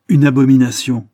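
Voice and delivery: rising indignation. A narrator's reading. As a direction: peak of the anger. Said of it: "en s'animant de plus en plus".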